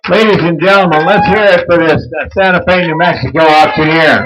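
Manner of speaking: loud, high applause call; rally the crowd to cheer; loud announcer. Real, not acted. Calls for applause for auctioneer.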